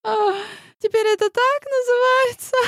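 high, laughing, groaning through tears of mirth; moaning with laughter. Acted. Astrologer crying with laughter at granny's joke, high and squealing. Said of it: "Простонала Вангелия, вытирая со смеющейся физиономии слёзы".

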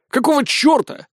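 very high-pitched shocked question; startled outburst. A narrator's reading. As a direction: blurt it out, voice shooting up. Потрясение от находки у стены. (потрясённый возглас)

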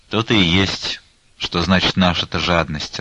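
usual speaking voice

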